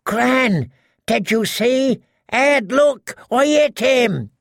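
bursting to share the news; excited. A narrator's reading. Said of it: "Sir Piggy sounded shocked".

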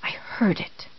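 breathy frightened whisper. A narrator's reading. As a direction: barely breathe the words. Inner voice, frightened thought.

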